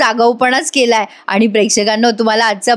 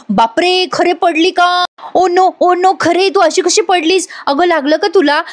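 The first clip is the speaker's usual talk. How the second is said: higher, hammed-up fake worry over a fall; mock concern; 7 semitones higher than usual. Husband fakes alarm as his wife slips, higher mock concern. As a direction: play the worried husband, overdone. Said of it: "तिथे बसल्या बसल्याच म्हणतो".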